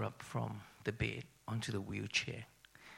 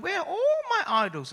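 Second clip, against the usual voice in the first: much higher and louder, a startled cry; shocked mother; 9 dB louder than usual. Retold or imitated. Mother wakes to find her idols gone, high startled cry. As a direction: shriek it like an old woman finding her things gone.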